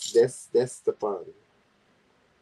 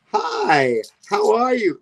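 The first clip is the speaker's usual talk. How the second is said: perky greeting; 7 semitones higher, 4 dB louder, slower than usual. Retold or imitated. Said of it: "He's gonna go".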